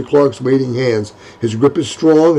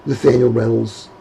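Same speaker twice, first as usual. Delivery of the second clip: usual speaking voice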